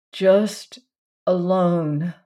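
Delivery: ordinary delivery, nothing marked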